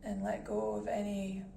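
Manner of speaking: calm guided meditation voice with long pauses. Acted. Meditation host guiding listeners to breath and body, calm and measured. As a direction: ease listeners into stillness, unhurried and even.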